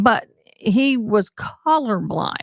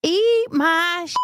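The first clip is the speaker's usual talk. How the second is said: quoting a film line; 9 semitones higher, slower than usual. Retold or imitated. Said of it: "film line".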